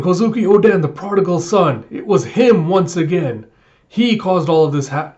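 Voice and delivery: loud, high accusing rant; hammed-up priestly outrage; angry denunciation. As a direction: blame the prodigal son. Priest denouncing Oden.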